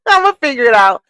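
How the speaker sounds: laughing. Real, not acted. Laughing at failing the poll.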